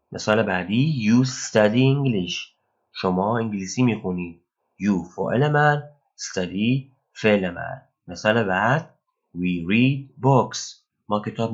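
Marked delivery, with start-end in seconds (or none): 1.1-2.4 slow, carefully spaced English; slow example read. Shown as a demonstration. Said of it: "teacher reads example sentence". 9.4-10.8 slow, carefully spaced English; slow example read. Shown as a demonstration. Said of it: "teacher reads example sentence".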